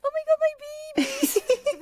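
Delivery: very high, drawn-out gushing squeal; cat-talk voice. Shown as a demonstration. Owner talking to her cat, high drawn-out cooing. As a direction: coo way up high, slowly, at a pet. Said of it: "if I'm talking to, like, my cat".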